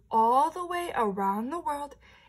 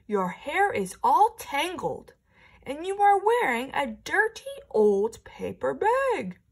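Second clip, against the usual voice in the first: snooty prince; 7 dB louder than usual. Acted. Prince scorning the princess for her paper bag, sneering. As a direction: look down your nose at her. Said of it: "did you listen to the way the prince was talking".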